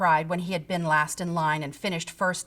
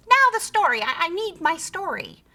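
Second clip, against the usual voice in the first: demanding creature; 8 semitones higher, 3 dB louder than usual. A narrator's reading. Creature tucked in, demanding a story. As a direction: demand it like a spoiled child.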